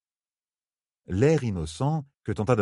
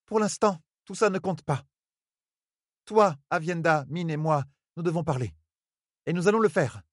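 put-on higher young woman, firm and unwavering; steady commanding voice; 9 semitones higher than usual. A narrator's reading. Elaine orders Rand to stay and talk, steady. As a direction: lay down the law, voice never trembling. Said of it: "d'une voix qui ne tremblait pas".

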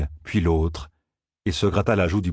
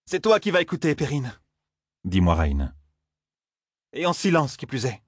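higher-voiced stern order, falling away; grim command; 6 semitones higher than usual. A narrator's reading. Aes Sedai orders the young man to listen silently, grim command. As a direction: firmly shut the young man up, mildly grim. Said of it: "son ton sinistre".

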